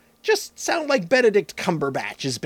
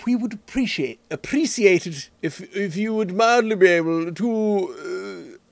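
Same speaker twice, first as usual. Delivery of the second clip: a hint of an overly polite aristocrat, taking his time; royal foppish British voice. Shown as a demonstration. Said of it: "my royal f-, extra foppish voice".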